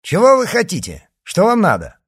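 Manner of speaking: high, loud demand; shouted challenge. Acted. D'Artagnan challenges riders surrounding him, loud and high. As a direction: call out sharply to the attackers.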